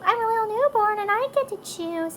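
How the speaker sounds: put-on high, loud little-kid voice; five-year-old's voice. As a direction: imitate a child speaking for the baby. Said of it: "my five-year-old will tell you".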